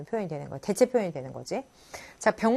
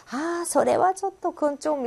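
slow, trailing evasive reply; evasive hedging; 7 semitones higher, slower than usual. Shown as a demonstration. A speaker politely dodging a request, slow and trailing. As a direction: dodge the question by trailing off. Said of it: "좀 이렇게 얼버무리면서 이렇게 피하는 식으로".

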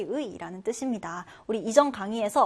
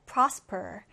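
prosper with the stress on PROS; first-syllable stress; 4 semitones lower than usual. Shown as a demonstration. Teacher modelling prosper for learners, first-syllable stress. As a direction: hit the first syllable of prosper, let the second drop. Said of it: "첫 음절의 강세를 두고".

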